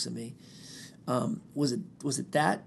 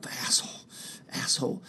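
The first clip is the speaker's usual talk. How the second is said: whispered, spitting asshole; spat insult; 4 dB quieter than usual. Retold or imitated. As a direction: spit the word out under the breath. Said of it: "spitting the word".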